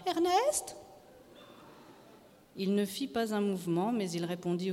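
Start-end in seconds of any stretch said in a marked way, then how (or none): 0.1-0.7 high-pitched questioning call; wife calling husband, voice lifted; calling his name. A narrator's reading. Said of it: "elle l'appela".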